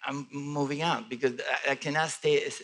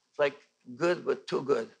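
ordinary talk, nothing marked

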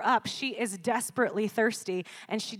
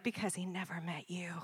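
low, whispery murmur; hushed pickup line; 3 semitones lower, 9 dB quieter than usual. Retold or imitated. Imitating boyfriend's murmured pickup line.

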